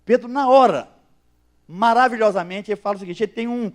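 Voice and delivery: normal talking voice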